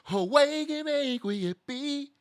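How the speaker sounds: high, stretched-out host's welcome; hammed-up show intro. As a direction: draw the show name out high and loud.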